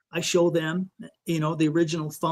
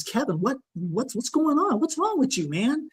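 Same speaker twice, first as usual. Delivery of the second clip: higher, concerned voice for the friend's questions; a hint of an anxious friend, pitched up; worried buddy; 6 semitones higher than usual. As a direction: imitate a worried buddy asking what's wrong. Worried friend quoted on phone.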